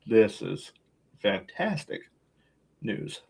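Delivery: quiet, deadpan sarcasm; sarcastic. Real, not acted. Co-host teasing his friend about annoying news, sarcastic. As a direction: feign delight in a low, dry voice. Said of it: "I'm being sarcastic".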